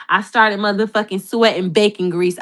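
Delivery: usual speaking voice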